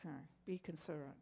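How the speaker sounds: hushed, quick self-reminder; thinking to oneself. Retold or imitated. Driver reminding herself while being yelled at, hushed thought. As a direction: say it low under your breath, as a thought. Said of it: "And so I thought".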